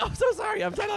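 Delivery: hammed-up squealed apology, very high; panicked apology. Flustered apology in the middle of the meltdown, imitated panic. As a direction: imitate a frantic, high-pitched sorry. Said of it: "she's, like, breathing heavy".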